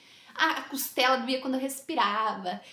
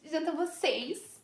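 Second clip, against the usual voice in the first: drawn-out, higher polite no; retold refusal; 5 semitones higher, slower than usual. Narrator telling the others to eat without her, mock polite. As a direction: decline slowly with put-on politeness. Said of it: "E aí eu falei".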